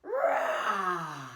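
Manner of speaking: a long, loud roar on the breath out; big lion roar. Acted. Teacher leads kids in a loud breath-out roar.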